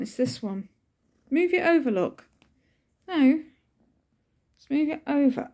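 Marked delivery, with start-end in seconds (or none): 1.3-5.5 pet talk. Real, not acted. Coaxing cat on her lap.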